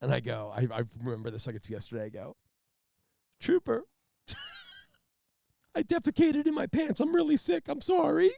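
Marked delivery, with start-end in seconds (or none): 3.4-5.8 high, loud blubbering apology; hammed-up crying plea; mock sobbing apology. Sobbing apology to the trooper, hammed-up and high. 5.8-7.0 laughing confession. Retold or imitated. 7.0-8.3 high, loud blubbering apology; hammed-up crying plea; mock sobbing apology. Sobbing apology to the trooper, hammed-up and high.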